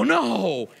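high, loud retold no; denial. Upholsterer denying to the customer, high and loud. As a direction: insist it's fine. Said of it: "I go".

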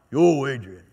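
low, slurred boxer call; low boxer impression, calling out; Rocky. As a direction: do a famous movie boxer's low holler. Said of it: "that's my Rocky".

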